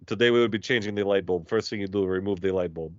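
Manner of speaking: put-on deadpan Russian accent, voice dropped. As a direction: parody a Russian how-to video. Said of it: "they're all by this Russian dude".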